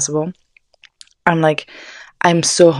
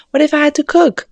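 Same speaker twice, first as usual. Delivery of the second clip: much higher and louder, indignant rant; exasperated outburst; 11 semitones higher, 5 dB louder than usual. Retold or imitated. Angry roommate's boyfriend hogs kitchen, higher louder outburst. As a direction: throw the question out in outrage. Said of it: "I was like".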